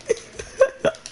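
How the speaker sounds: high squealing wheeze of laughter; wheezy laugh. Real, not acted. Laughing at his own meme joke, high wheezing laugh. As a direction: let out a squeaky silent wheeze.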